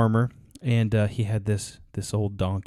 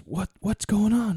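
mock-panicked, much higher voice; hammed-up panic, pitched way up; panicking donkey; 9 semitones higher than usual. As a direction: squeal it like a frantic trapped animal. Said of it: "he started screaming and panicking".

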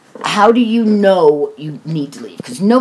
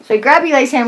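normal talking voice

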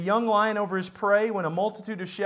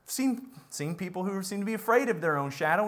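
ordinary talk, nothing marked

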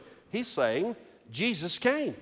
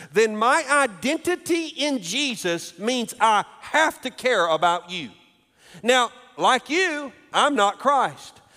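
louder, higher pulpit voice driving each phrase home; full-force preaching; 6 semitones higher, 5 dB louder than usual. Acted. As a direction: preach it out to the whole room with rising fervour.

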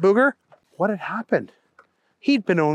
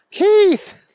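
high, louder cry of recognition; shouted name; 5 dB louder than usual. A narrator's reading. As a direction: shout your buddy's name. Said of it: "Booger shouted".

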